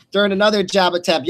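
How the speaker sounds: ordinary delivery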